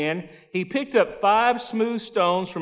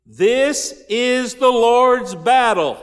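slow, weighty and louder; preacher stressing the point to the congregation; emphatic preaching; 4 semitones higher, 4 dB louder, slower than usual. Real, not acted. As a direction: hammer each word home. Preacher drives home key line.

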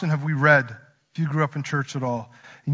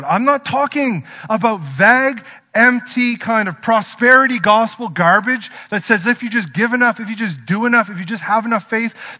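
higher, louder fiery preaching; preacher denouncing prosperity gospel, fired up; preaching at full force; 5 semitones higher, 5 dB louder than usual. Acted. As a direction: drive it home with conviction.

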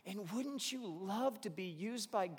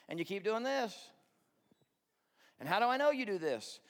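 ordinary talk, nothing marked

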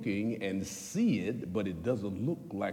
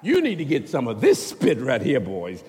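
loud pitchman hawking relic; mock salesman; 6 semitones higher, 9 dB louder than usual. Preacher hawking genuine spit to the boys, mock pitch. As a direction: sell it like a street hustler.

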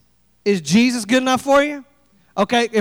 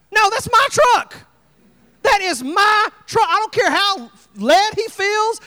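higher, indignant protest; hammed-up possessive outrage; mock outrage; 7 semitones higher than usual. Indignant over his own truck.

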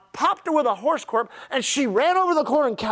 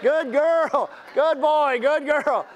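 playful petting praise; sweet talk like praising a good dog; 3 semitones higher, slower than usual. Retold or imitated. A light mock of the pastor praising church members like pets. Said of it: "why we're not always petting on you".

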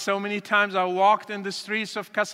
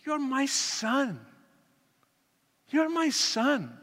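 pained plea; 3 semitones higher, 4 dB quieter, slower than usual. Retold or imitated. Father pleads he is his son.

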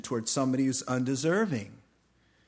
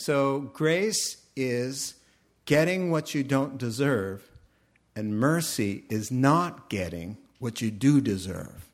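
slow, deliberate explanation; slow explaining to son; 3 dB louder than usual. Retold or imitated. Dad answering his son's question, slow and deliberate. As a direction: spell it out slowly for a child. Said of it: "And I said".

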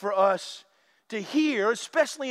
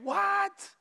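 startled what; 7 semitones higher, 5 dB quieter than usual. Retold or imitated. Disciples reacting to flesh talk.

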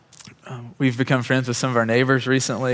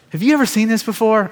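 much higher and louder, astonished; excited disbelief; 10 semitones higher, 6 dB louder than usual. Retold or imitated. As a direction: blurt it out in amazement. Healed man asks, freaked out.

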